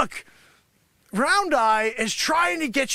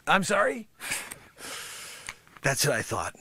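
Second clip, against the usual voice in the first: ordinary delivery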